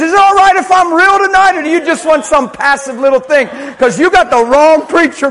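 shouted high, loud sermon; loud passionate preacher, not angry; fiery preaching. Acted. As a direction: blast it at the congregation with a laugh in it. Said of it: "Can you tell I'm not mad at you?".

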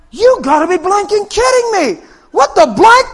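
high, loud, acted amazement; incredulous shout. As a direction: yell in comic disbelief. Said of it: "never seen nobody go like this".

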